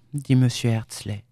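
usual speaking voice

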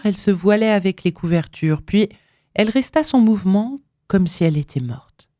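usual speaking voice

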